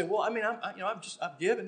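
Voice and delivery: lightly voiced squirming listener; defensive churchgoer. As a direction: stammer out excuses like a cornered congregant. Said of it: "And you might say".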